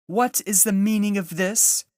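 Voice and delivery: high, loud commanding demand; the witch queen demands an answer sharply; imperious witch queen. A narrator's reading. Witch queen confronting the feasting animals, cold commanding voice. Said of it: "asked the witch queen".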